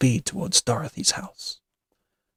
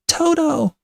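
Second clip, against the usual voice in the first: very high, loud long-distance call; 5 dB louder than usual. A narrator's reading. Dorothy searches for her dog, calling. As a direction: holler for a lost dog.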